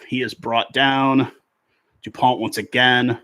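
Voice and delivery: rugby commentator calling the phases; live play-by-play. Real, not acted. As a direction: call each pass, lifting into the names.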